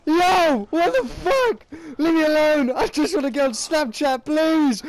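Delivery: high, loud frantic yell; shrieking at the screen; panicked yelling. Real, not acted. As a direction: scream it out in panic.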